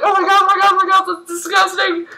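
high, grossed-out mock scream; squealed mock panic; retold screaming. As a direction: rattle it out in high-pitched horror. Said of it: "Like, screaming".

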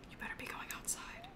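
quick unvoiced whisper; whispered threat to the dog; whisper warning.

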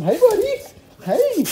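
very high, loud squeal of a greeting; excited pet talk. Real, not acted. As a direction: greet the puppy in a gushing high voice. Greeting his dog Ransom.

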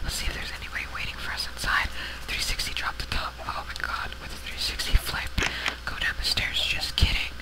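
whispered creeper voice; playful stealthy whisper. Pretend. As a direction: sneak along in a hushed whisper. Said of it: "talking in the creeper voice".

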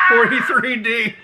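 high shout through laughter; laughing shout. Real, not acted. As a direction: blurt it back laughing. Repeating mom's answer, laughing.

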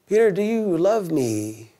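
slow, deliberate asking; slow probing question. Retold or imitated. Jesus asking Peter a second time, slow and searching. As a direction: draw it out, pressing gently. Jesus asks again, slower.

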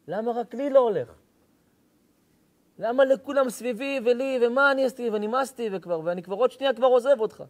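mock anguished prayer, building to breaking point; desperate plea. Man reenacting his complaint to God, anguished pleading.

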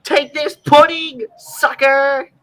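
slow, high, triumphant jeer; gloating taunt. Acted. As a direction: rub it in with glee. Gloating card play taunt.

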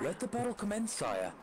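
put-on British accent of a loyal knight. Knight answering his king in battle, mock British accent. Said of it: "attempt to do my best British accent".

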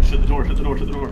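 loud urgent yell; panicked shout. Real, not acted. Enemy pushing in, panic.

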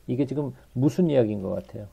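ordinary delivery

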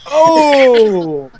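sharp, high, loud oh; surprised. Real, not acted. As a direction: gasp out a shocked oh. GM reacts to dagger betrayal.

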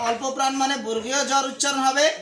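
normal talking voice

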